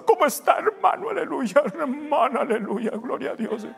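high-pitched, over-churchy greeting; mock preacher greeting. Pastor mimicking a brother greeting after the service, high churchy voice. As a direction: greet in an exaggerated praying intonation. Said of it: "Así habla, pero no hablamos así".

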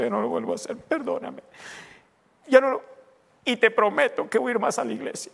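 hammed-up whimpering repentance, pitch jumping; mock pleading. Pastor imitating a believer's morning apology, exaggerated tearful pleading. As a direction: beg and promise like an overdone penitent. Acts out guilty believer begging again.

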